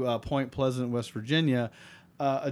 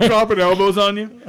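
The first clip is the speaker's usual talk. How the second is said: laughing; 7 semitones higher, 12 dB louder than usual. Real, not acted. Laughing wrestling joke.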